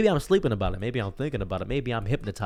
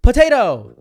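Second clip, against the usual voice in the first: yelled potato; 12 semitones higher, 14 dB louder than usual. Retold or imitated. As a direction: shout it out high and loud.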